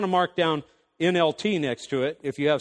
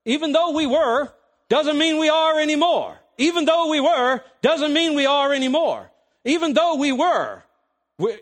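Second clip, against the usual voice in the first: much higher, louder pulpit voice, words hammered; full-force preaching; 9 semitones higher, 5 dB louder than usual. Acted. Preacher pressing the point on the congregation, fervent preaching. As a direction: preach it with fire, pounding each phrase.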